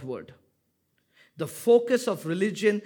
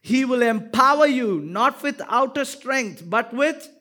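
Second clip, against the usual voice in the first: booming scripture reading; 7 semitones higher, 9 dB louder than usual. Acted. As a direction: read the verse out with full force for the church to follow. Reading verse at full force.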